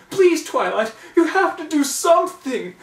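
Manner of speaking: a fussy unicorn begging tearfully; sobbing plea. A narrator's reading. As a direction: melodramatic high wailing plea.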